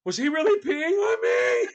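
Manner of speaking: high, loud cry of disbelief; shrill-pitched shocked question, hammed-up; mock outraged shriek. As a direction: imitate a scandalized lady shouting. Ladies outraged at fake peeing.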